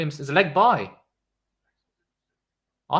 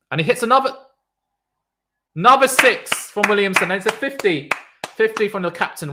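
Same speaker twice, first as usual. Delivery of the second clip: loud, high commentary burst; excited call; 7 semitones higher, 7 dB louder than usual. Real, not acted. Streamer calling the captain's fifty, loud and thrilled.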